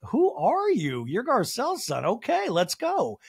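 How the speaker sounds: rally him with swooping pitch; hammed-up rousing quote; Crystal. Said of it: "And Crystal's like".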